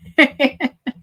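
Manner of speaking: bright laugh. Real, not acted. Teacher laughing after reassuring students, bright laugh. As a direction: laugh off the joke.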